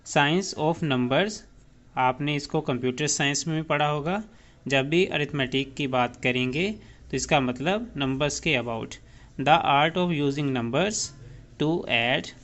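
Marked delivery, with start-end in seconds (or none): 0.0-1.3 science of numbers spelled out very slowly; very slow dictation. Shown as a demonstration. Teacher slowly dictates the meaning to students. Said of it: "arithmetic किसे बोलते हैं?". 9.4-12.3 slow dictation. Shown as a demonstration. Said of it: "dictating definition".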